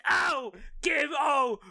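put-on loud, high British drunk ranting. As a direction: rant like a sloshed Brit. Drunk rants about species.

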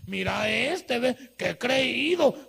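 slow, drawn-out sneer at a show-off; mocking envious gossip. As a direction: stretch "qué creído" out with scorn. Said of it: "¿qué le dice?".

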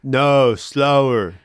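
co-host echoes the agent's demand in a put-on voice; mimicking the phone agent. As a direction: do the rep's odd voice again. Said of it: "that's pretty good".

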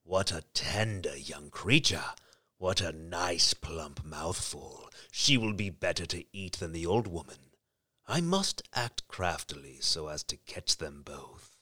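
low, slow, greedy wolf. A narrator's reading. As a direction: savour each word like a hungry wolf. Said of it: "The wolf thought to himself".

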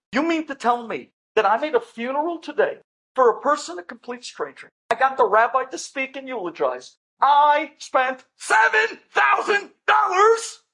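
furious at the wasted funeral money; outraged disbelief. Acted. Son yelling at nurse over the wrong burial, outraged shout.

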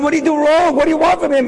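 high, pleading protest; indignant outcry. Acted. Preacher defending the mocked boy, high indignant outcry. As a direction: cry out on the boy's behalf.

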